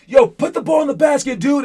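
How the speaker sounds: high, loud mock coach yell; imitating a yelling coach. Coach retelling how a coach yells at players, loud mock yell. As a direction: bark it like a coach on the sideline. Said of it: "when he yells at you".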